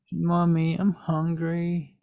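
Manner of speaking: put-on high, drawn-out little kid whine; small child. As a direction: plead like a hungry four-year-old. Game master voices small child.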